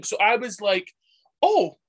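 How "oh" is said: high, loud startled oh; surprised oh. Retold or imitated. Retelling her reaction, a high startled oh. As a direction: gasp out a surprised oh.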